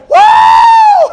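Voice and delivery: very high, loud shrieked wow; woman's wow. Retold or imitated. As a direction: squeal it like an amazed churchgoer. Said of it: "I heard one woman say".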